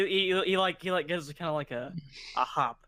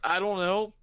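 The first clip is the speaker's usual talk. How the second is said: higher, halting mock panic; flustered stammer; 5 semitones higher, much slower than usual. Dijo cornered by his angry companion, flustered stammering. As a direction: stumble for an answer, pitched up.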